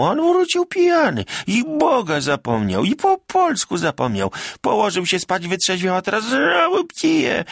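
high, loud peasant wife keening; wailing lament. A narrator's reading. Magda lamenting to the tavern listeners, wailing. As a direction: wail your grief to the crowd. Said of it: "poczęła lamentować".

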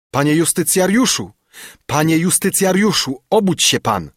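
high, urgent wake-up call; insistent servant rousing his master. A narrator's reading. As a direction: call loudly through a closed door. Servant calls through door.

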